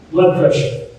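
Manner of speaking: ordinary talk, nothing marked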